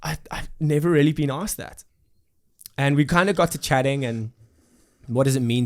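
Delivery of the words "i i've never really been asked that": excited. Retold or imitated. Retold surprised reply to friend.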